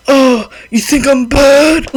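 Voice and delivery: slow, lightly voiced smug bad boy; a hint of the moody heartthrob taunting; brooding bad boy voice. Mimicking the French dub of James Beaufort, mock brooding. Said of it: "Like, a little bit like that".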